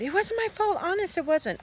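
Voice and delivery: put-on high, pleading girl's wail; crying protest. A narrator's reading. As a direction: plead innocence in a high acted whine. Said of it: "Sheila cried".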